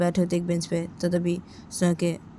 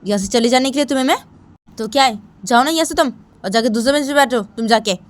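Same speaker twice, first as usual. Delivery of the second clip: loud, higher, angry schoolgirl telling her off; angry scolding; 4 semitones higher, 8 dB louder than usual. Acted. Simran ordering Sunaina off the bench, angry and loud. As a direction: snap at her to get off the bench. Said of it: "तुम इतना गुस्सा क्यों कर रही हो".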